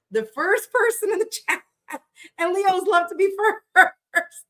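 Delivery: high, giggling through the words; laughing. Real, not acted. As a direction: laugh while teasing her. Laughing while teasing viewer.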